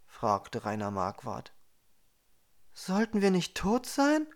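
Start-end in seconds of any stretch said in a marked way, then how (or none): 2.7-4.3 higher, bewildered question; confused. A narrator's reading. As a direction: ask it in disbelief. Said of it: "fragte Chiara verwirrt".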